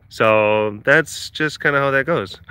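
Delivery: ordinary talk, nothing marked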